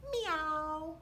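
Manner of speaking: sung-out kitty meow; a cat's meow. Acted. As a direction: meow like a cat. Dog says meow.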